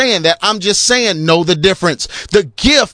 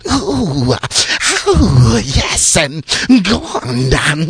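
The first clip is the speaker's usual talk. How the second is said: low, slow, moaning pulpit voice; preacher sounding like a demon; 5 semitones lower, much slower than usual. Retold or imitated. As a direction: moan it like a possessed preacher. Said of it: "sound like a demon".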